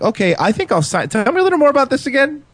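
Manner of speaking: overly interested act; feigned keen interest. Pretend. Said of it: "seem really interested".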